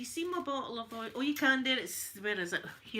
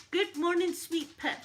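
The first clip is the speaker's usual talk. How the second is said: higher and louder sing-song hello; bright greeting; 8 semitones higher, 5 dB louder than usual. Real, not acted. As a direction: greet her like a dear friend. Greeting a viewer in chat.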